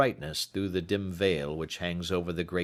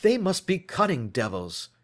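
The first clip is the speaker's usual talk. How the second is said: higher, heated exclamation; exclaiming; 8 semitones higher, 3 dB louder than usual. A narrator's reading. Holmes exclaims to Watson about the killers. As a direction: spit out the accusation. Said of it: "he exclaimed".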